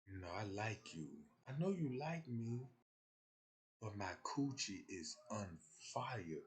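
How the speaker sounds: understated soft-spoken woman; soft female confession. Retold or imitated. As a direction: confide it quietly like she's embarrassed. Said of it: "Till a female told me".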